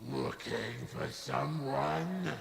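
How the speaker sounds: slow, soft processed demon; pitched and layered with reverb. Shown as a demonstration. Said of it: "And there you go".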